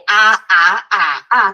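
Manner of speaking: "a, a, a, a" loud, each with its own tone contour; four Mandarin tones. Shown as a demonstration. Teacher shows beginners the confusing tones on "a", tone drill. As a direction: step "a" through the four tones. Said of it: "por causa dos tons".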